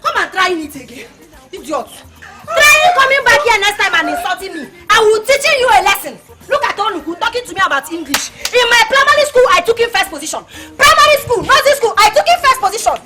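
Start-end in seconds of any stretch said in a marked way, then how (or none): 2.5-6.1 loud threat to the neighbour. Acted. Threatening rival loudly. 8.5-13.0 proud, loud bragging; boasting loudly to the neighbour. Acted. Boasting loudly about school.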